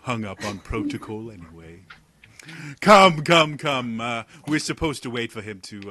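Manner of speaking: a wry noble teasing the jumpy guards; Talon's voice. Acted. Talon scolds the guards for their glaives, mock weary.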